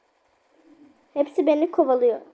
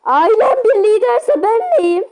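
indignant surprise; 6 semitones higher, 5 dB louder than usual. Real, not acted. Joking outrage at game.

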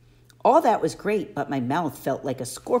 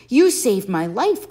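put-on slow, louder, high satyr boy voice; Grover, grateful; 5 semitones higher, 7 dB louder than usual. A narrator's reading. Satyr thanks Percy for saving him, slow grateful voice. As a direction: thank him slowly and earnestly. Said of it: "Grover said".

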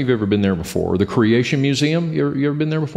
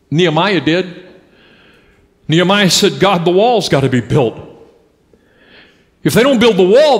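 raised, ringing preacher's voice; preaching at full force; 8 semitones higher, 4 dB louder than usual. Acted. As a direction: drive it home to the room, louder and higher. Preaching to the congregation.

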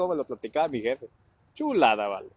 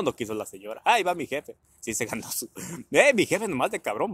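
usual speaking voice

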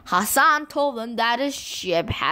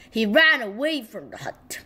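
ordinary delivery

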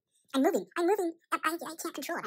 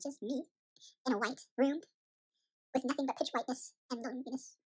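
ordinary talk, nothing marked

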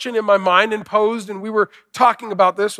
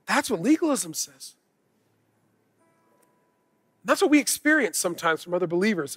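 ordinary talk, nothing marked

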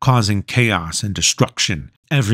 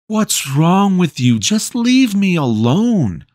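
higher, slower fed-up plea; frustrated teen voice; 9 semitones higher than usual. Acted. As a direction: push him away, exasperated. Lucas snaps at Zeke.

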